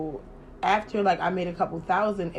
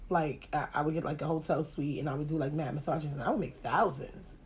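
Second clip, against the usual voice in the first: ordinary talk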